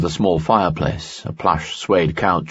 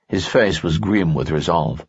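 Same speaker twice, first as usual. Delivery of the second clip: ordinary delivery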